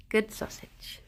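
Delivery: breathy whisper to the bunny; soft whispered praise. Real, not acted. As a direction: whisper it to the pet.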